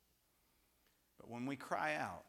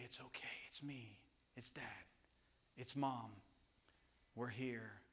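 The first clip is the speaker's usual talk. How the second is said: reassuring parent; 7 dB quieter than usual. Retold or imitated. Parent comforting frightened child.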